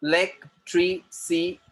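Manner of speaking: "Electricity" slowly cut into separate syllables. Shown as a demonstration. English teacher models a word for students, syllable by syllable. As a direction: break "Electricity" apart, one beat per syllable. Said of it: "syllable में cut करके बोलना".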